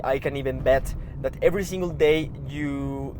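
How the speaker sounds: normal talking voice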